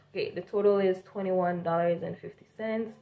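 usual speaking voice

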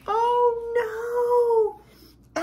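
high, drawn-out storybook dismay; dramatic dismay. Acted. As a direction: stretch out a high, worried oh no. Dismay at the sleeping girl.

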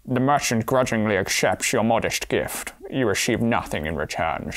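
slow, low Scottish impression; Sean Connery. Player reading the merchant card in character, Scottish star impression. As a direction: read slowly as a slurring Scottish star. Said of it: "That's good Sean Connery".

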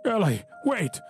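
high pleading call; calling after him. Acted. Tremor calling Eli back on the sidewalk, high.

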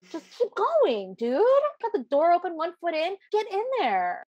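high-pitched pleading at the screen; exasperated urging. Acted. Horror fan urging the character through the open door, exasperated. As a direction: urge them on in high exasperation.

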